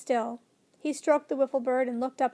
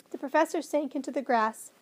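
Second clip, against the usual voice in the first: plain reading voice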